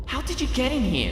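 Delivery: lightly voiced surprised heroine; Twilight, surprised. A narrator's reading. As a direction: ask the visitor in amazement.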